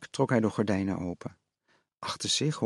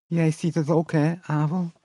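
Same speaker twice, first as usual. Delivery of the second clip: higher, lisping and uneasy, a touch hushed; soft lisping mother; 5 semitones higher than usual. A narrator's reading. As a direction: ask him gently, afraid of the answer. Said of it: "zei ze zacht".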